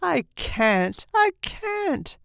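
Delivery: anguished moan, leaping high on the repeat. A narrator's reading. Father dreads the streets, moaning high. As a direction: moan it helplessly. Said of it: "he moaned".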